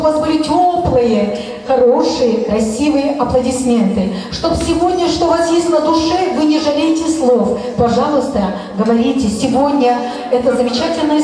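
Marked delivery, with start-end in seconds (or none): none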